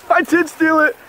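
high, teasing admission; gleeful confession. Acted. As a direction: own up with a cheeky grin. Gleeful admission after denial.

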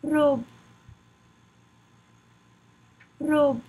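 French "Robe" with a French r; "Robe" modelled in French for learners; French pronunciation drill. Shown as a demonstration. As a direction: say "Robe" the French way, one short syllable. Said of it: "Practice saying it with me".

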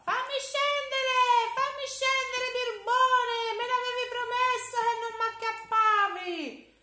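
put-on a trapped child shrieking to be let down; boy in a sack scolding the giant; shrieking little boy. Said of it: "Piccirullino strillava".

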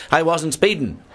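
protesting innocence in a joke; defensive protest. Retold or imitated. Driver's protest in told joke.

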